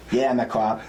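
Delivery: "cop" as "kwop", Boston style; Irish Boston cop voice; Boston accent. Acted. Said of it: "put a U in there".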